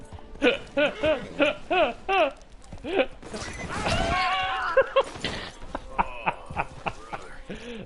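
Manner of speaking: long, loud helpless laugh; real laughing fit at the game; burst of laughter. Real, not acted. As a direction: crack up at the glitch. Game glitch goes wrong.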